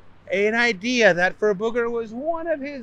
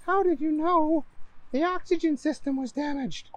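high woman voice; 10 semitones higher than usual. Acted. Donna asks Booger.

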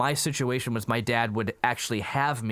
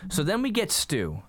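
ordinary talk, nothing marked

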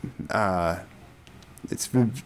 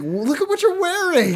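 much higher, teasing other churchgoers' voice; mock pointed remark. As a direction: point out his sweater with mock disdain. Retelling Seth Rogen movie scene.

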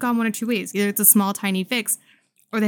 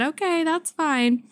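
retold self; 9 semitones higher, slower than usual. Own reply in retold call.

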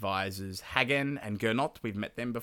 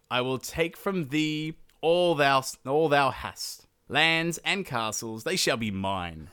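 slow, raised-voice mock-epic declamation; grandiose challenge; 3 semitones higher, 4 dB louder, slower than usual. Siegfried claims the king's lands and castles, grandiose challenge. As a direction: proclaim it like a conquering knight, drawing out the words.

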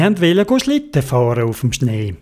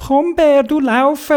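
high little-animal voice; urging squirrel; 11 semitones higher, slower than usual. Acted. Squirrel urges the frozen bear to run, high urgent voice. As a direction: squeak encouragement urgently. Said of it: "Und das Eichhörnchen hat gesagt".

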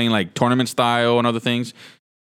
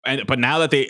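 ordinary talk

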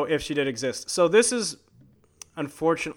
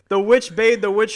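usual speaking voice

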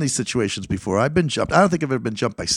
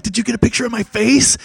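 pitched way up, keen to know; high, excited plea for a photo; eager high question; 12 semitones higher, 5 dB louder than usual. Retold or imitated. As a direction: ask like a kid wanting a souvenir.

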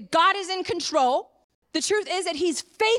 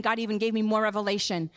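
normal talking voice

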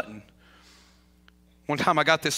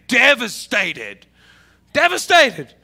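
"devastated" drawn out slowly, then louder and higher; stressed repetition; 5 semitones higher, 7 dB louder, much slower than usual. Shown as a demonstration. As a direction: lean hard on "devastated", stretching it. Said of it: "she was devastated".

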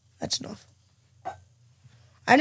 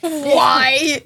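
laughing shout; 3 semitones higher, 4 dB louder than usual. Real, not acted. Mock outrage at burger joke.

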